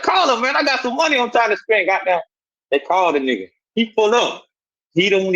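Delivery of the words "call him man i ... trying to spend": high-pitched retold urging; re-enacted pitch to the homeboys, raised high; acted eager request. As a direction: push the pitch up, urging them to call. Acting out his eager request.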